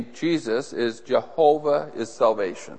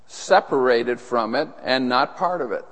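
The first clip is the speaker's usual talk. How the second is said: usual speaking voice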